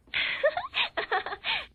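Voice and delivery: very high squealing laugh. Real, not acted. Reader laughing at pulling girls on bike, high laugh. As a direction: let out a giggly squeal.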